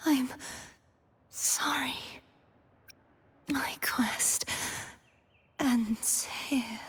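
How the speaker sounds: weak, breath-heavy last words; dying gasp. Acted. Defeated knight mourns a broken sword, dying gasp. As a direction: force the words out between failing breaths.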